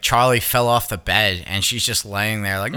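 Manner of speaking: usual speaking voice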